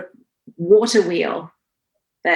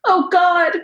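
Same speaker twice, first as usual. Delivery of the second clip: put-on much higher, louder wail of a small child; imitating her four-year-old wailing, much higher; crying child; 12 semitones higher, 4 dB louder than usual. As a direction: play the little girl crying from the splinter. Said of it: "she was like".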